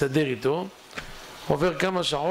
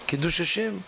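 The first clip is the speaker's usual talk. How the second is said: plain reading voice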